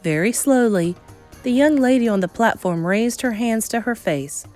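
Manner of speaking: usual speaking voice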